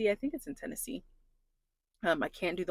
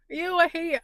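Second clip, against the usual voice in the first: holding back tears; 10 semitones higher, 4 dB louder than usual. Real, not acted. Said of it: "trying not to cry on the internet".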